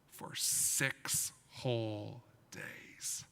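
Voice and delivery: slow hushed whisper. Acted. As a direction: whisper it slowly, for effect. Dramatic retelling for children.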